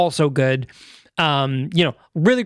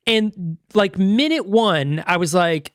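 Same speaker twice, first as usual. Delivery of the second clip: usual speaking voice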